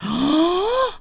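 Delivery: sharp rising gasp of alarm; a startled intake of breath; shocked gasp. Acted. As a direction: gasp at the bill. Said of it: "घबरा जाती है".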